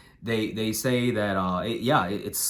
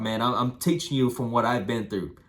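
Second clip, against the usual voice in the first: normal talking voice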